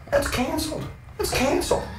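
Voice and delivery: high-pitched, needling threat; feigned politeness pitched high over a threat; mock menace. Storyteller warning the blokes off Eric, mock menace.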